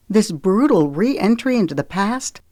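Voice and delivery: ordinary delivery, nothing marked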